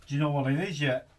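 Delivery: put-on quick, loud Australian accent; a fast Aussie catchphrase. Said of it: "in an Australian accent".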